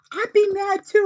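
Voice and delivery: high, mock-huffy reply; imitating him, huff it out defensively; defensive agreement. Boyfriend siding with Usagi.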